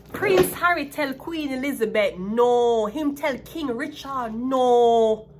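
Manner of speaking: "no" loud and drawn out; slow, loud, hammering "no" at each end; emphatic insistence. Real, not acted. Reader insisting Harry told the Queen no, emphatic. Driving the point home, incredulous.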